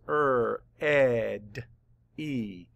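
"Er, ed, e" as separate drawn-out sounds; sounded out. Shown as a demonstration. Teacher mapping the sounds of ready, sounded out. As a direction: segment it sound by sound, ending on long "ee". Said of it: "Let's map the sounds".

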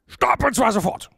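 high, loud, gapless command; words run together. A narrator's reading. Porcupine orders Mia to halt, words jammed together. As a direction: blurt it as one word, sharp and high. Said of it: "keine Lücke und Pause zwischen ihnen".

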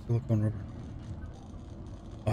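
ordinary talk

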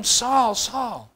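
understated, drawn-out divine question; hushed questioning. Acted. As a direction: ask it slowly and softly, letting it die away. Heavenly voice questions Saul, softly.

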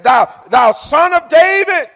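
high, loud call pitched to carry; crying out. Acted. Blind man calls to Jesus, crying out. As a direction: cry out to be heard over the crowd. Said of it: "he started crying out".

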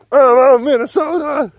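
high loud mocking voice; mock them with a shrill yell; mock Minnesotan shout. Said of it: "mocking Minnesotan voice".

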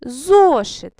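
Зошит with a loud, long ЗО; loud, emphatic ЗО in Зошит; stressed first syllable. Shown as a demonstration. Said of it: "у цих словах наголошений склад".

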